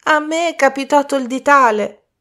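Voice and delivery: put-on high-pitched childish whine; whining.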